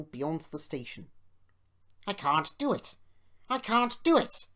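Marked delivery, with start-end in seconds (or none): 2.1-4.5 loud, raised protest, building; exasperated engine giving up; grumbling. A narrator's reading. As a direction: complain in defeat, higher the second time. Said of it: "he grumbled".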